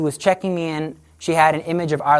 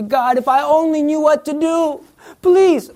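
much higher, begging voice for the desperate prayer; a lost soul begging God for an answer; pleading prayer; 11 semitones higher, 4 dB louder than usual. Retold or imitated. Preacher voicing the desperate believer, high pleading. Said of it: "How often in our life do we say".

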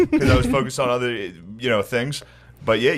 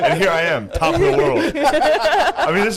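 high laughing brag; laughing triumph. Real, not acted. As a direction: crow with laughter, on top of the world. Mock triumph holding the leash.

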